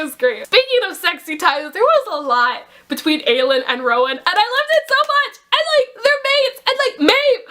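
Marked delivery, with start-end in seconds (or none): 4.2-7.4 much higher and fast, gushing with delight; excited gushing. Real, not acted. Fan gushing to viewers about the couple, excited and fast. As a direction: race through it, thrilled about the couple.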